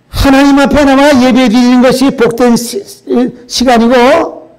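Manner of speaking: loud, high sermon close; preaching declaration. Real, not acted.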